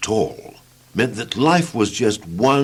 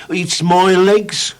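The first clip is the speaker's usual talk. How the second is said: drawn-out, higher woeful voice; slow mournful complaint; 9 semitones higher, 4 dB louder, much slower than usual. Acted. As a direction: moan about the legs slowly. Gloomy Mister Tall answers.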